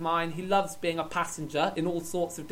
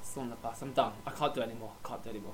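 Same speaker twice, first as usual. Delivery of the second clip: low, fading away to very soft; defeated; 9 semitones lower, 9 dB quieter, much faster than usual. Pretend. Poet telling his friend he can't go on, defeated. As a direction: give up, trailing into a small low voice. Gives up, overcome by his poem.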